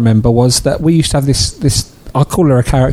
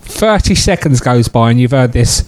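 ordinary talk, nothing marked

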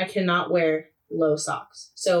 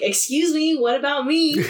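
mock indignant [name]; 7 semitones higher than usual. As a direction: play it as a judge feeling left out. Mimicking [name]'s indignant interruption.

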